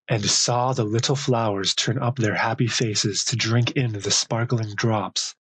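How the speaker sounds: ordinary delivery, nothing marked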